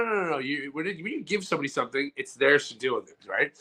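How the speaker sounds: ordinary talk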